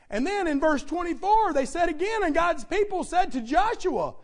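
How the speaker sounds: loud, ringing scripture proclamation; full-force preaching. Real, not acted. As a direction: declare the people's vow from the pulpit.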